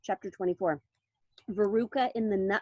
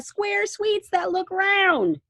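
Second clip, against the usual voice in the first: excited; 9 semitones higher, 5 dB louder, much slower than usual. A narrator's reading. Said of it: "cried Mister Wonka".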